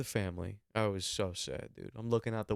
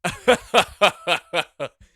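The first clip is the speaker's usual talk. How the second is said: loud high booming cackle; Jeff Bezos laugh; 7 semitones higher, 13 dB louder than usual. Pretend. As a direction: let out a huge honking billionaire laugh. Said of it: "Do the Jeff Bezos laugh".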